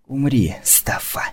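hissed, breathless threat; hissing. Acted. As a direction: spit it out in a venomous hiss. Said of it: "прошипела она". (шипящий шёпот)

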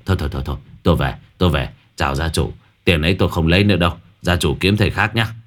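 scared shaman. Acted. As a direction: back off fast, rattled and out of breath. Shaman fleeing in fear.

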